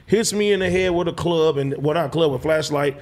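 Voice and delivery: ordinary talk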